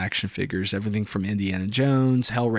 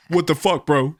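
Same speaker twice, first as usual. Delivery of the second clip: high, loud indignant bro voice; mock angry friend; 5 semitones higher, 5 dB louder than usual. Friend confronting the carrot prank, mock indignant. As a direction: act out the friend's outrage.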